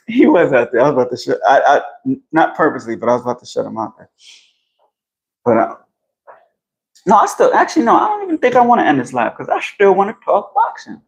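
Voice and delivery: usual speaking voice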